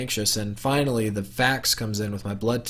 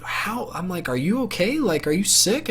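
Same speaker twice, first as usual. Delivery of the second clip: high, startled questions; a little disbelieving, worried; shocked concern; 7 semitones higher than usual. Retold or imitated. To his skinny brother, shocked and higher-pitched.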